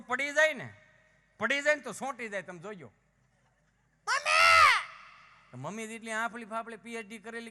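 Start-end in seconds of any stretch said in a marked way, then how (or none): 2.9-4.7 long, high, loud cry for mom; child wailing. Retold or imitated. Fallen child calling his mother, loud high wail.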